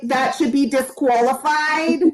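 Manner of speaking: slow, drawn-out, exaggerated imitation of Rhonda's accent; horrible imitation of Rhonda's accent. Contestant protesting a rival's answer to the host, mock imitation of Rhonda's accent. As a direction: stretch the words out in a badly mimicked accent like Rhonda's. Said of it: "my horrible imitation of your accent".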